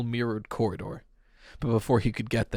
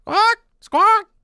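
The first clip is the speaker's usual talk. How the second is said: very high, loud parrot squawks; 10 dB louder than usual. A narrator's reading. Parrot squawking back at Pete, loud and very high. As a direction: screech like a wild parrot. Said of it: "The bird was bright green".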